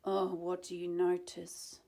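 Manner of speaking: plain reading voice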